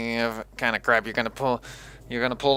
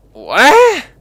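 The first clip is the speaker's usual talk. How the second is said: high, loud, stunned yelp; shocked, loud exclamation; incredulous outburst; 12 semitones higher, 12 dB louder than usual. Real, not acted. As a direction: shoot the pitch up in disbelief. Gamer stunned by bad news.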